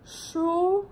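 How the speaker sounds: Su said with the tongue curled back, Mandarin shū. Shown as a demonstration. Teacher shows students to curl the tongue on Su. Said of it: "Cong lưỡi lên ha".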